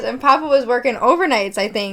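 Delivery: ordinary talk, nothing marked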